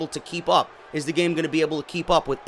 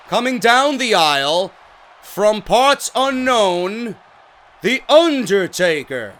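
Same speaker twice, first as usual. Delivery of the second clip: mock ring announcer, stretched out and raised; Lillian Garcia impression; 3 semitones higher, 4 dB louder, slower than usual. Gamer mocking the game's awful Undertaker introduction, drawn-out announcer impression. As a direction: draw out a lame in-game wrestling introduction, lacking bass. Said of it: "Lillian Garcia was awful sounding in every game".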